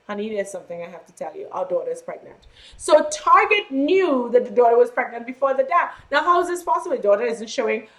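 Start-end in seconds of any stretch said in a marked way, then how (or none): 0.1-2.4 mock-soft quick words to her husband; gentle wife confession. Wife quietly telling the upset father, softer and quicker. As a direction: break the news quietly, a touch hushed. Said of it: "the wife said to him".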